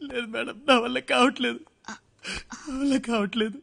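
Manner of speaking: tearful plea. Acted. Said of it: "ఏడవకు".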